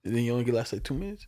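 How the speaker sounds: normal talking voice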